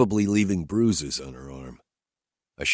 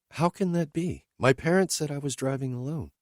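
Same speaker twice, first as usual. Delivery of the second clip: higher, lightly voiced woman asking bluntly; higher woman's voice, questioning her driver; Whitney's voice; 9 semitones higher than usual. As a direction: lift into her voice, direct and searching.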